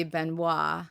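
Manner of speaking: "Benoit" said ben-WAH; "Benoit" ending on an open WAH; French pronunciation. Shown as a demonstration. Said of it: "But it's French".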